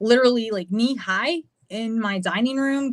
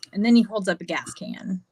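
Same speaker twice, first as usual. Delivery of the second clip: usual speaking voice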